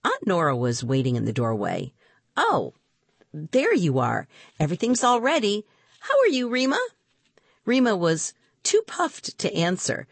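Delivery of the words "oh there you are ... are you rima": aunt's cheerful greeting. Acted. Aunt greets kids.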